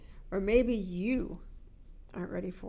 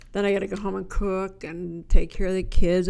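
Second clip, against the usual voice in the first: whiny complaining; 2 semitones lower, 9 dB louder than usual. Retold or imitated. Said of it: "you don't get up and say".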